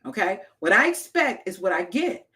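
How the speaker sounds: ordinary talk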